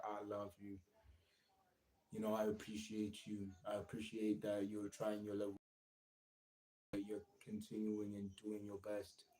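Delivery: soft, hushed words to yourself; gentle self-talk. Shown as a demonstration. Streamer models kind self-talk, softly and quietly. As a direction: tell yourself kindly, under your breath. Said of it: "tell yourself that".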